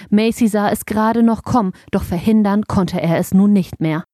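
ordinary delivery

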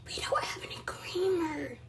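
hushed dismayed whisper. Real, not acted. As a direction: breathe it out in horror.

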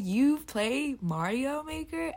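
slow, drawn-out doubtful question; skeptical question. Acted. Listener doubting a sweet streamer plays the game, skeptical. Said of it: "you're like".

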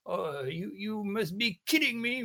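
badly faked English accent, drawn out in disbelief; mock English accent. Podcaster imitating an excited British sudoku streamer, in an exaggerated English accent. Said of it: "avec un méga accent anglais".

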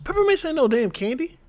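high, loud disbelief; incredulous objection. Real, not acted. Friend disputing that peppermints count as candy, incredulous. Mock argument over candy.

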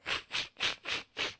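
breathless wheezy cartoon snicker; cartoon wheeze laugh. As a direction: do the cartoon dog's hissing laugh. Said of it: "He used to go".

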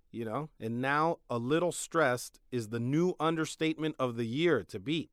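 normal talking voice

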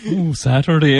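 mocking echo. Mum teasing her son's way of saying Saturday, mocking echo. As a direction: echo it back in mock-posh teasing. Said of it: "And she was like".

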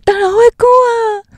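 high, loud, slow cry of disbelief; incredulous outburst. Real, not acted. Co-host scolding the guest over the haircuts, incredulous outburst. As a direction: throw it out in shocked disbelief.

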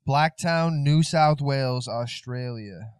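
loud, drawn-out Australian place names; big, slow Aussie twang; Australian accent. Acted. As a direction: announce it slowly in an Australian accent. Naming Australian hometown, Australian impressions follow.

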